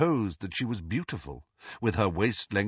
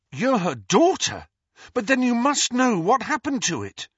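high, loud, urgent amazement; excited realisation; 13 semitones higher, 6 dB louder than usual. A narrator's reading. Harry realises the ghost must know, excited. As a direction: pounce on the new clue.

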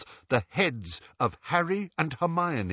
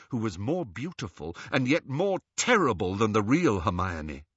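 usual speaking voice

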